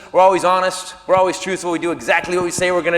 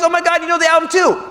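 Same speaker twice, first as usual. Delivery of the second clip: high, quick imitation of a surprised woman; high-pitched female exclamation; woman caller; 10 semitones higher, 3 dB louder, faster than usual. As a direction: lightly voice a delighted woman caller. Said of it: "She goes".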